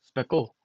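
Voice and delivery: "Speckle" for special, with a hard k; spelling pronunciation. Shown as a demonstration. Showing chat how special is spelt, sounded out. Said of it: "Special is speckle".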